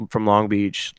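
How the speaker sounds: ordinary delivery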